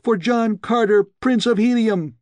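very high, loud triumphant shriek. A narrator's reading. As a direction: proclaim his name in fury. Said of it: "she shrieked".

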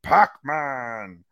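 booming ring introduction; Michael Buffer impression. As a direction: stretch each name for the arena. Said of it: "That's my best, uh, Michael Buffer".

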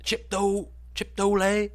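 high squeaky chip dough; mock mispronunciation. Mocking dad's mispronunciation.

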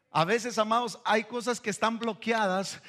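usual speaking voice

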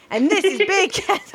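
high, breaking into a laugh; laughing through it. Retold or imitated. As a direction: crack up mid-line. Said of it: "I fucked it up".

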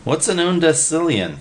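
a wide-eyed eager pal; excited simple guy. A narrator's reading. Narrator voices Willie.

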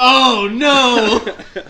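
loud laughing oh no; mock alarm. Teller sliding toward a tree, loud laughing alarm. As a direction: cry it out as you slide. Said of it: "And I'm like".

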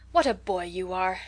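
mildly disgusted reproach. A narrator's reading. Mother to her sulky son, mildly disgusted. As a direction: scold with weary disgust. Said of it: "gave a disgusted sigh".

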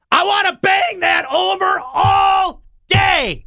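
high, slow shout. Acted. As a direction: belt it out with glee. Sports host erupts on radio.

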